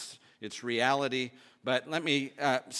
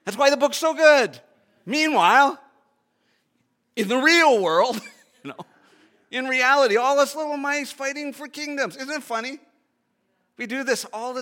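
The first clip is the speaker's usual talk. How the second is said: excited preaching; 9 semitones higher, 8 dB louder than usual. Real, not acted. Excited sermon peak.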